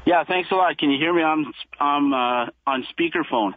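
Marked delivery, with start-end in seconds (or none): none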